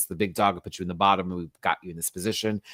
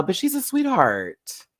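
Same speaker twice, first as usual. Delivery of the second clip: ordinary talk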